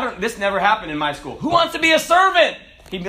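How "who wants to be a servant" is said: high, loud, eager question; teacher calling out. Acted. Teacher polling kids on career day, loud and high. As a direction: throw it out to the whole classroom.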